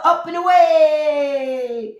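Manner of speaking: superhero cry. Pretend. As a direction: cry it out like a cartoon Superman launch. Trump flying like Superman.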